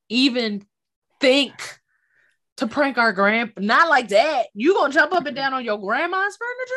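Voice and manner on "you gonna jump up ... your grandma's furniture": fast, disbelieving question; hint of outrage; incredulous. Real, not acted. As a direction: ask it like you can't believe it.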